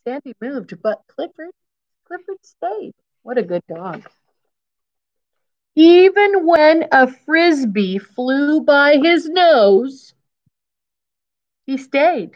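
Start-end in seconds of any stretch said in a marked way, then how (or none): none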